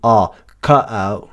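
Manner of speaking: slow cutout with back vowels, London style; London pronunciation. Shown as a demonstration. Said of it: "elle le prononce très en arrière aussi".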